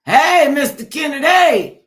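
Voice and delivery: loud, high, slow call across the room. Real, not acted. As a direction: call him in from far off. Calling a guest into the room.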